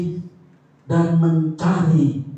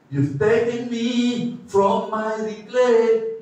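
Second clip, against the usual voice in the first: ordinary talk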